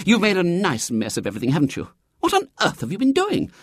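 stern, quick, high-pitched scolding. A narrator's reading. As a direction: scold him briskly, rising to an exasperated high question. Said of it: "she said sternly".

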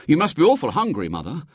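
put-on high, quick, cheery boy; chirpily oblivious, dropping on the last word; cheerful. A narrator's reading. William pressing food on his seasick mother, cheerfully. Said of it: "said William cheerfully".

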